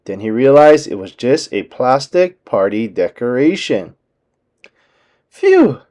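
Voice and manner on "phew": a high relieved "Phew!". A narrator's reading. Mickey laughing off his fright, high relieved phew. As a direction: let the breath out in relief. Relief after skeleton scare.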